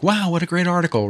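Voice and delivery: high, hammed-up delight over an article; mock gushing praise. Commenter gushing over an article, mock cheerful. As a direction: gush like a fake fan. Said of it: "doesn't always have to be all happy, crappy".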